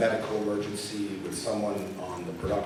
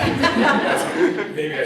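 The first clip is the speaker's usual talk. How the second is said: laughing; 9 semitones higher, 11 dB louder, much slower than usual. Real, not acted. Laughing at own fake gun.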